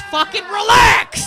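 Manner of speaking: loud, high, drawn-out yell; mock-exasperated shout. Real, not acted. Comic telling the audience off, shouted. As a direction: scold them at full volume.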